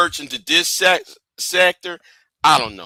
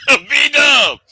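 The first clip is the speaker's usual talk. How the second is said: loud, higher-pitched shout of the name; punched-up, raised-voice call-out; 7 semitones higher, 5 dB louder than usual. Real, not acted. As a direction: holler it like a hype call. Calling out the name louder.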